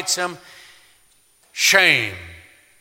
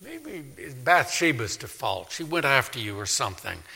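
softer, hedging mock excuse; mock sugarcoating; 2 semitones higher, 7 dB quieter than usual. Prophet flattering the king, soft hedging mock tone. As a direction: mockingly soften the blow. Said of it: "powder it up and say".

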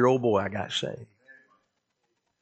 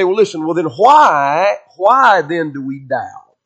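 doubting listener; 4 semitones higher, 7 dB louder, slower than usual. Retold or imitated. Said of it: "Then you say".

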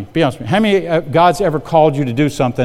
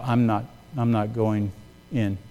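low, soft, slow refusal; timid refusal; 5 semitones lower, 9 dB quieter, slower than usual. Retold or imitated. New manager refusing his boss at the bar, timid and quiet. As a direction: haltingly stand your ground, very quietly.

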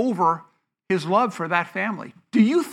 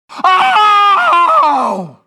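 loud high mourning wail; demo wail; 10 semitones higher, 9 dB louder than usual. Shown as a demonstration. Preacher demonstrating a first-century mourner's wail, loud. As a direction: let out a full wail like a hired mourner. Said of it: "did you hear people wailing at the top of their lungs".

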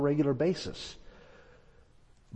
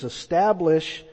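slow scripture quote; slower than usual. Shown as a demonstration. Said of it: "He says".

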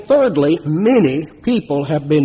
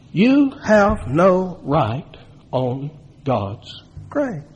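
slow solemn emphasis; much slower than usual. Real, not acted. Preacher to the congregation, slow and weighty. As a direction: lay each word down heavily.

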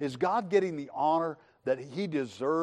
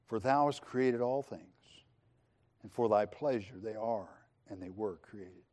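slow, hushed, lower reading; solemn scripture reading; 4 semitones lower than usual. Acted. Preacher reading the elders' song, slow and hushed. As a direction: let the verse fade reverently. Reads the heavenly song slowly.

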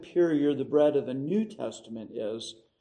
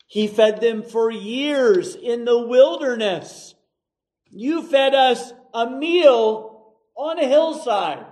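louder, higher, slowed indignant protest; a hammed-up grumbling crowd, raised and slow; 7 semitones higher, 7 dB louder, slower than usual. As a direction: throw it back at him like an offended old-timer. Crowd voiced complaining about Jesus.

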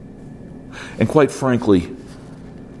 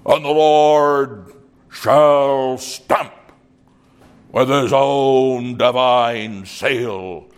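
put-on slow, lilting Irish preacher's accent, higher; Irish brogue; 4 semitones higher, slower than usual. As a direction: intone it like an Irish field preacher. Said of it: "a beautiful Irish brogue".

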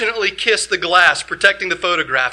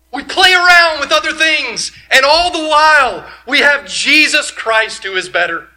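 preaching at full force; 9 semitones higher, 6 dB louder than usual. Acted.

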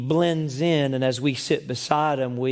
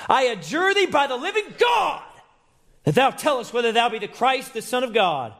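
angry high priest shout; 12 semitones higher than usual. Acted.

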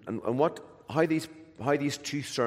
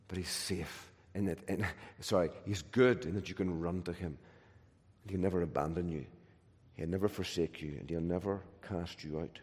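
softer, gentle promise of never being abandoned; soft reassurance; 6 semitones lower, 9 dB quieter than usual. Acted. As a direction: comfort the listener quietly. Gentle assurance of God's goodness.

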